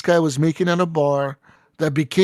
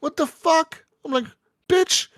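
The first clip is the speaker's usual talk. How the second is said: very high, squeaky outraged What the fuck; shrill indignant disbelief; offended squeal; 13 semitones higher than usual. Retold or imitated. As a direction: spit out an insulted high-pitched Bitch. Insulted by the hostess.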